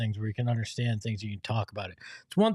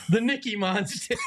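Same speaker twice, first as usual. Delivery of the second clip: laughing shout; 8 semitones higher than usual. Retold or imitated. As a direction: yell it out through laughter. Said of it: "As Jeremy says".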